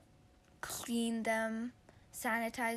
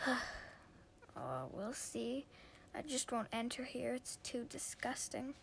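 sighing disdain; 9 dB quieter than usual. Acted. Boy leaving his sister's room, quiet sighing disdain. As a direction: sigh and brush her off. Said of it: "He was like".

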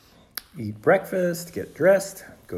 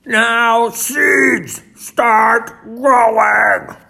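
full, high, drawn-out shout; 10 semitones higher, 12 dB louder, much slower than usual. A narrator's reading. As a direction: bellow the order at the seeds. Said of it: "and shouted".

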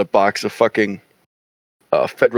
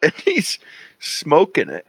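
usual speaking voice

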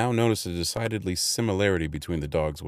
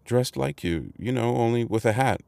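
put-on raised, nervous boy's voice; a frightened boy explaining, pitched up; mild trembling boy; 7 semitones higher than usual. A narrator's reading. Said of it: "I explained, trembling".

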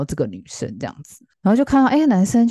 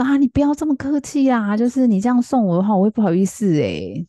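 embarrassed protest; 6 semitones higher than usual. Retold or imitated. Girl embarrassed by second gift.

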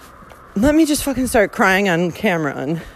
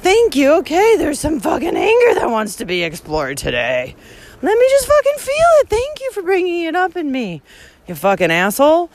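mock gratitude; 7 semitones higher than usual. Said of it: "is like".

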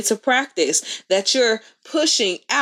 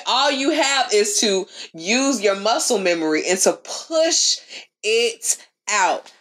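ordinary delivery

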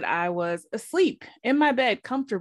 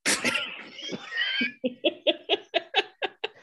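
shrieking laugh; 5 dB quieter than usual. Real, not acted. Host cracking up at her mom's reply, high shriek. Big laugh after punchline.

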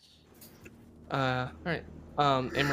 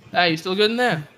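loud, raised call through a door; 7 semitones higher, 9 dB louder than usual. Acted. Guard checks on people behind a door, calling out.

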